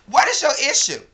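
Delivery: loud, high demanding question; angry yell. Real, not acted. Yelling at the friend who woke her, furious question.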